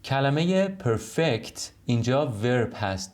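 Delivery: usual speaking voice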